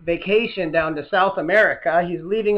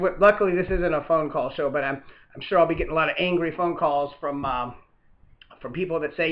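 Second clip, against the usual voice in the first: ordinary talk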